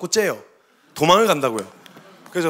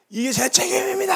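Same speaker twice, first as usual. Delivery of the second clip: 오열 흉내; 10 semitones higher than usual. Retold or imitated. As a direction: sob it out like the distraught pastor. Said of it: "얼마나 속이 상하셨는지 목사님이". (목 놓아 오열하듯)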